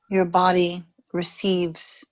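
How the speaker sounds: ordinary delivery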